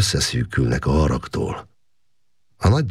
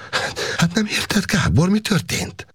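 much higher, frightened plea with a breathy start. A narrator's reading. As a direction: she is scared and begging him to understand. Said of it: "Szolga Erzsébet megrémült".

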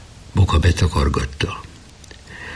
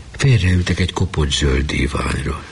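usual speaking voice

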